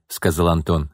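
plain reading voice

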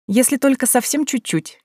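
high-pitched flirty agreement; coquettish. A narrator's reading. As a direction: agree coyly, playing the modest guest. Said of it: "кокетливо согласилась Лида". (кокетливо)

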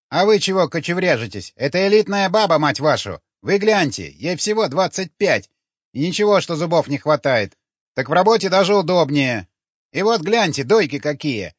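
high, loud street pitch; pushy pimp hawking. A narrator's reading. Dwarf pimp hawking to passers-by. (назойливый зазывала, громко)

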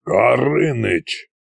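slow, drawn-out growl. A narrator's reading. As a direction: growl the name as a warning. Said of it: "прорычал Кощей".

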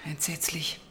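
whisper. Acted. Inspector reacts to grim news.

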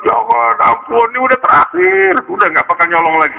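tearful begging. Acted. Boy caught a second time begs for mercy, acted crying. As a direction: wail for mercy like a child, hammed up. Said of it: "Dia nangis".

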